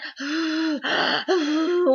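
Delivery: long frantic puffs of breath; blowing ink dry. Retold or imitated. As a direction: blow hard and fast as if drying ink.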